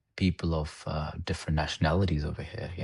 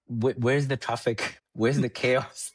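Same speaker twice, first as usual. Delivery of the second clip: amazed laughing; 5 semitones higher, slower than usual. Retold or imitated. Kid amazed at quiet streets.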